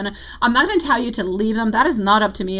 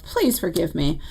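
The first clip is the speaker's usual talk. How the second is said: ordinary talk, nothing marked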